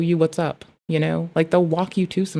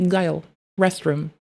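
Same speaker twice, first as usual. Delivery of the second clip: mild stern command. Retold or imitated. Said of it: "intonation in my voice would discern".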